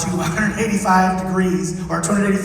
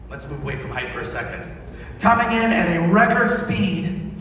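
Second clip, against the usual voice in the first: usual speaking voice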